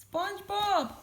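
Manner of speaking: high, terrified cry; acted alarmed call, pitch shooting up; Squidward. A narrator's reading. Squidward aimed at by SpongeBob, terrified cry. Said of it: "cried terrified Squidward".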